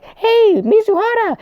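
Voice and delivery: very high, loud squeaky call; hammed-up falsetto shout. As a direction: call her name in a leaping high voice. Acting the character calling out.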